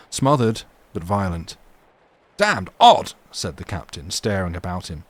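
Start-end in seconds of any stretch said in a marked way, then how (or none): 2.4-3.1 much higher and louder, a baffled outburst; loud exclamation. Acted. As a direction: bark it out in disbelief. Captain hears another cough.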